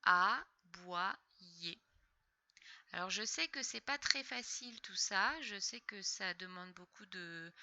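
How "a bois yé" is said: "A, bois, yé" in three separate beats; syllable-by-syllable reading. Shown as a demonstration. Teacher decoding a word for a pupil, syllable by syllable. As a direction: sound out "A, bois, yé" with pauses between. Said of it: "ça fait yé".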